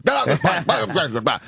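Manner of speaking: garbled, obnoxious drunk impression; mumble-yell it comically, half laughing; Tony Clifton. Mimicking the drunk on the tarmac, garbled obnoxious whine. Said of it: "It sounded like Tony Clifton".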